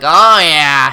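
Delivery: very loud, lecherous mock moan; hammed-up cry of creepy enjoyment; creepy chiropractor. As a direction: belt it out as a leering creep.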